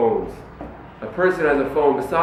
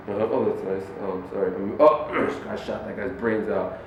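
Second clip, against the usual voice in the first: mock absent replies; 6 semitones lower, 6 dB quieter than usual. Mocking distracted phone replies.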